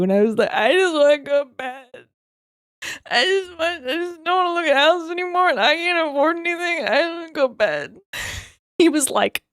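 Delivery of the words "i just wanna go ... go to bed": replaying her own tearful whine, higher; a teary, worn-out mock sob, pitched up; retold sobbing plea. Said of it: "then I just started sobbing".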